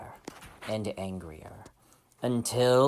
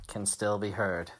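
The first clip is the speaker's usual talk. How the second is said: ordinary delivery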